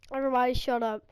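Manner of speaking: ordinary talk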